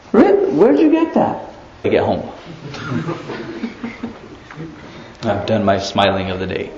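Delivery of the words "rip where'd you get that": high mocking teen voice; imitating a rude kid. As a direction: sneer it like a bratty teenager. Mocking kids' slang retort.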